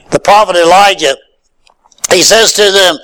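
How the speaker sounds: ordinary talk, nothing marked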